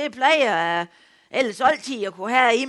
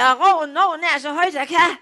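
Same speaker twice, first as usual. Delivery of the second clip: loud, higher, repeated even harder; louder repeat shout; 6 semitones higher, 6 dB louder than usual. Retold or imitated. Farmer repeating to the doctor who didn't hear, louder shout. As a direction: bellow it again for the deaf line. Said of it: "Jeg sagde".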